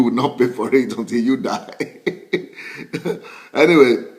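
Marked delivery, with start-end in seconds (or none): 1.8-3.5 chuckling to oneself; short trailing chuckle; amused laugh. Real, not acted. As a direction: laugh it off after a grim joke. Laughs after joke about dying.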